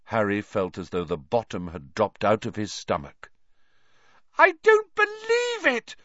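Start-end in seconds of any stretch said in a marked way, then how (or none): 4.4-6.0 very high, loud scream of disbelief. A narrator's reading. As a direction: scream it out in outrage. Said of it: "Hermione screamed".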